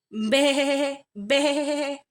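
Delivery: high bleating baa; bleating sheep. Acted. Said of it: "bleated the sheep".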